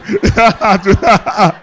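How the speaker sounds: a big real laugh at his own story; hearty laugh. Real, not acted. Preacher laughing at his own supper story, hearty laugh. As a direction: let the laugh roll out.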